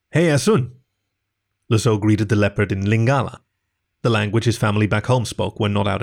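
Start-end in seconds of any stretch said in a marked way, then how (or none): 0.1-0.7 friendly call. Acted. Lazo greets leopard in Lingala.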